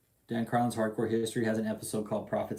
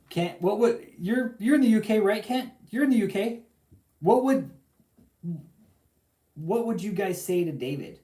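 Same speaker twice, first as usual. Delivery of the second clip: calling out; 10 semitones higher, 7 dB louder than usual. Real, not acted. Calling out to a UK listener.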